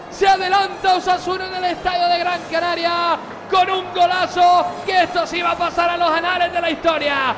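shouted goal call, loud and high; goal commentary shout. Real, not acted. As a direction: yell the goal like a stadium commentator.

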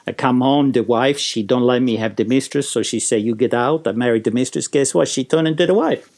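grumpy mock Italian accent. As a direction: moan in broken English with a thick Italian accent. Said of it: "who was a bit of a grump".

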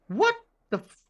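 sudden high startled exclamation; baffled cop. Retold or imitated. Officer reading the report, high and startled. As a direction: cut off mid-curse in disbelief.

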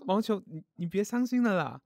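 high, loud comforting plea; urgent consoling. Acted. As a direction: hurry to console a crying friend. Consoling a crying friend.

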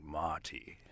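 imitated British pronunciation; British accent. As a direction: say "Marty" with a light English accent. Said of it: "when you have a British accent like".

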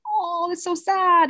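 mock sad mom. The kidnapped girl's mom to the wife, hammed-up pity. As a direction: play the mom gushing with fake sympathy. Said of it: "And the mom's like".

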